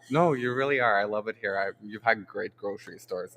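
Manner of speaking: normal talking voice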